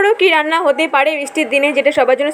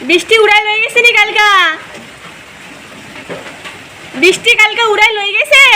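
high, raised voice in village dialect; calling out in dialect; 7 semitones higher than usual. Real, not acted. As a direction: shout the question so she can hear. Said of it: "ঠাকুমা শুনতে পাচ্ছে না".

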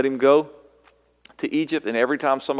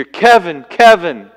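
loud, high call, name twice; God calling out; 7 semitones higher, 15 dB louder, slower than usual. Pretend. God calling Kevin by name, a loud high summons. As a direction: call out from above, ringing.

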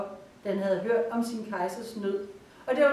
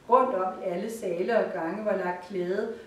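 usual speaking voice

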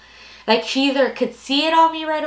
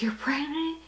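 whisper; 5 semitones higher, 9 dB quieter than usual. Retold or imitated. Mom guessing, shocked.

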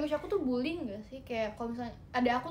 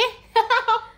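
high laugh; 9 semitones higher, 10 dB louder than usual. Real, not acted. Laugh alone on line.

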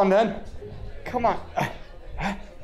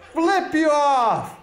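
high, drawn-out taunt; slow, high play-angry holler; mock drunken insult; 7 semitones higher, much slower than usual. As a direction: stretch it out as a playful drunken jeer. Insulting someone in bar game.

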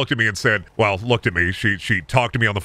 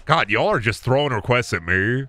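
ordinary talk

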